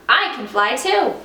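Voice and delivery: put-on young bat thrilled she can fly; excited. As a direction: burst with joy. Excited thought.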